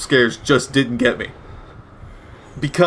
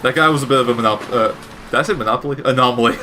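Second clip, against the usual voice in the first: ordinary talk, nothing marked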